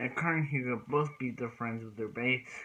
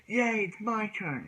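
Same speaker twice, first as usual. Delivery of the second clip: high, drawn-out retold cheer; excited cheer; 8 semitones higher, slower than usual. Hyuga claiming the next turn in battle, mock excited. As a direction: pipe up eagerly, imitating an excited player.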